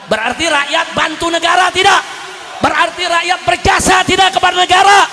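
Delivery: high, shouted rallying questions; loud, impassioned rally call; fiery preaching. Real, not acted. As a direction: drive the crowd up to a roared takbir. Preaches at full force.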